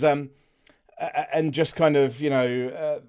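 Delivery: ordinary delivery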